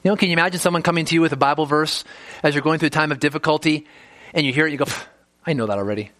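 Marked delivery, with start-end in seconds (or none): 4.8-6.1 dismissive low mutter, imitating a scoffer; scoffing. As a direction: brush it off with a mock sneer. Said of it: "That kind of scoffing and mocking attitude".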